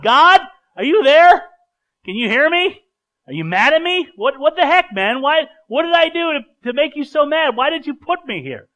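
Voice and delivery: high, loud, indignant shouting at heaven; yelling at God. Acted. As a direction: yell up at God, demanding answers. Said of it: "I may even yell at God".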